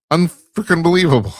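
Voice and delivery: high-pitched disbelief breaking into a laugh; incredulous. Real, not acted. Host scoffing at organizers who didn't check, incredulous.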